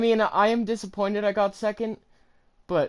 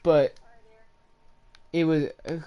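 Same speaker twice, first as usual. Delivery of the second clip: glitchy robot voice from lag; robot voice with digital stutter. Real, not acted. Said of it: "does anyone hear me talking like a robot".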